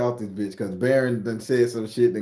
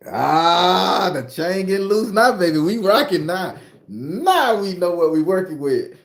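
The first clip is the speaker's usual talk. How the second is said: hyped up; 6 semitones higher, 6 dB louder, slower than usual. Acted. Hyped about the lesson.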